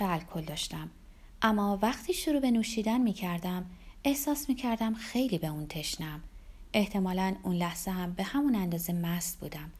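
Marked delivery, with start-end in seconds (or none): none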